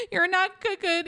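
high-pitched mock tearful voice; imitated crying. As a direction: whimper it like a tearful reality-show scene. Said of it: "crying about him".

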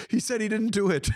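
high, laughing through the line; imitating a chuckling colleague. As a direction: tell it as if barely holding back a laugh. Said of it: "like, chuckling".

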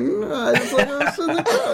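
a hint of Tommy Wiseau, half-laughing; Tommy Wiseau impression. Podcast host mimics the weird Russian thug, laughing impression. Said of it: "There's a little bit of Tommy Wiseau in that".

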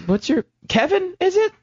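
high, airy tipsy question, rising up; ditzy drunk girl forgetting a name; tipsy giggly girl. Retold or imitated. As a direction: girlfriend on the phone, tipsy, pitched high and giggly. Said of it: "like, little tipsy, like, giggly".

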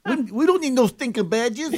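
bad Latin accent. Co-host tries the badges line, botched Latin accent. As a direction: attempt a Latin accent and miss, near laughter. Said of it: "Give us a little Latin flavor".

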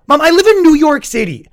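high and loud exasperation; exasperated disbelief. Retold or imitated. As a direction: shriek it at mom in disbelief. Exasperated reply to mom.

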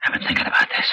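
conspiratorial whisper. Acted. Old gangster letting his son in on the scheme, whispering. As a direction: lean in and whisper it.

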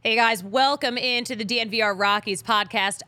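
usual speaking voice

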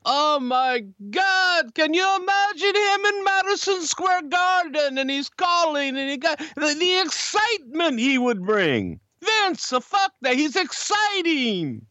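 very high strained excited impression; high-pitched, over-the-top pleading excitement; Pat's voice. Retelling Pat's excited plea to Vince.

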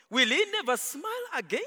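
ordinary talk, nothing marked

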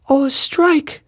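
put-on very high little-girl exclamation; Rivkala crying out. A narrator's reading. Daughter reacts to father's strike news, high shocked cry. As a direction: squeal it as a shocked small daughter. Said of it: "Rivkala cried out, covering her mouth".

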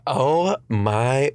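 ordinary talk

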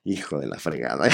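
low muttered laughing exclamation; mother laughing, muttering in pride; mom laughing. Retold or imitated. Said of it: "mamá dice".

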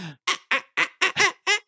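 high squeaky wiper noise; wiper squeak. Pretend. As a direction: squeak like a dry wiper blade. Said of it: "they go".

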